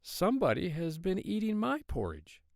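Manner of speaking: middle-sized bear voice, pitched well up; a high mother-bear voice at normal volume; Mama Bear. A narrator's reading. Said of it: "said in her middle-sized voice".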